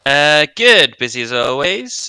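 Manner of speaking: much too loud into the mic; booming right into the microphone. Real, not acted.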